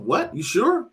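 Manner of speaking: high, startled "You sure?"; mock disbelief. Man hearing his bill is five dollars, mock disbelief. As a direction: act stunned by a tiny bill.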